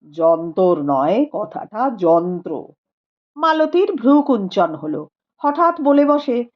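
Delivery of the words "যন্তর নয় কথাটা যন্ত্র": lower, measured learned man; a calm scholar speaking gravely; Rupendra's voice. A narrator's reading. (নিচু গম্ভীর গলায় পণ্ডিত রূপেন্দ্র)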